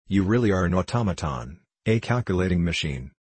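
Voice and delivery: usual speaking voice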